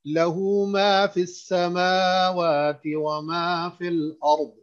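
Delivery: slow melodic chant with long vowels; Quran recitation. Shown as a demonstration. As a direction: stretch each vowel in measured recitation. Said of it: "फिर पढ़ता हूँ".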